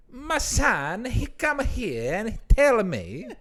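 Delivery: a proud Italian father, hammed-up Italian accent; Italian father boasting, slow Italian accent; Italian man. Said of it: "And I can do".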